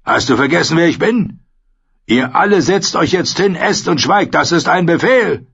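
high, insistent outrage; angry command. A narrator's reading. Reddened Lord Commander orders mutinous men down, angry command. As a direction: pull rank in rising fury.

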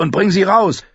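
high-pitched urgent shout; urgent order. Acted. Fighter ordering a rescue, urgent shout. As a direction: order it out over the noise of battle.

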